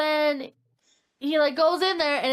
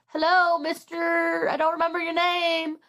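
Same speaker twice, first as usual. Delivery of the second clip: tentative calling out; 6 semitones higher than usual. Retold or imitated. Man at neighbor's open door, calling out tentatively. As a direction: call out for whoever might be home.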